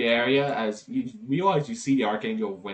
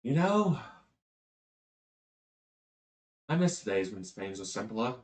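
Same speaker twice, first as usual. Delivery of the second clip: wistful archangel. Acted. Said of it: "And he's like".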